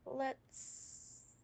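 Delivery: hissing like a snake; drawn-out hissed s. Real, not acted. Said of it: "sorry, I sound like a snake".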